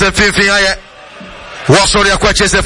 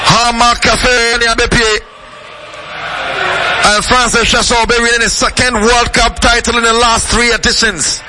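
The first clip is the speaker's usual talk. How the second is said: live play-by-play; 2 semitones higher than usual. Real, not acted. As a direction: announce the matchup like a radio caller. Live match commentary.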